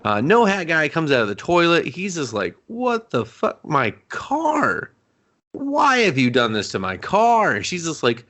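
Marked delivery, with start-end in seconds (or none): 2.7-7.5 acted indignant, high-pitched disbelief; mock outrage. Man finding his car wrecked, high mock outrage. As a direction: protest like a wronged car owner, pitched up.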